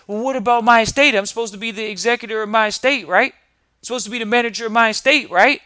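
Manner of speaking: mock eager client. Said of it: "But then they say".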